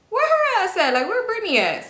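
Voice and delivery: high, loud pressing question; pushy demand. Retold or imitated. Retelling a guy demanding to know where Brittany went, pushy.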